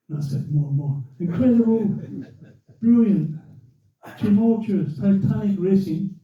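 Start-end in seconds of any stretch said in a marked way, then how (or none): 1.2-6.2 higher, hyped-up announcer piling on adjectives; mock grandstand commentary; radio commentator. Commentator praising the race, imitated gushing announcer. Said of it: "He used more adjectives".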